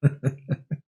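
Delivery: short, quiet self-mocking laugh; soft chuckle. Real, not acted. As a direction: chuckle softly at your own bad attempt. Chuckles at his own bad accent.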